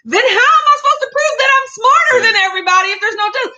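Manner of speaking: high, fast, loud protest; high-pitched comic rant, rushing along; mock outrage. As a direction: exclaim it in hammed-up indignation.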